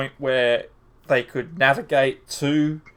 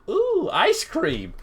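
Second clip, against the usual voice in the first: put-on high, slow, childlike ooh; excited; slower than usual. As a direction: gasp with delight at ice cream. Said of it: "They looked to the left and went".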